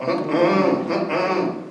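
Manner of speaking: soft mm-mm of refusal; disapproving mm-mm. Shown as a demonstration. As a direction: shake off something wrong with a hum. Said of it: "when something is wrong".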